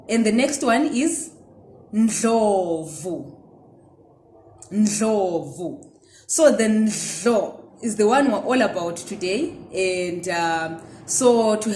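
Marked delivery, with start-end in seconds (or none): 1.9-6.0 Ndlovu with a nasal n before the dl; Ndebele surname. Shown as a demonstration. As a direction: say Ndlovu twice, clearly. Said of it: "let's see if you can hear the difference".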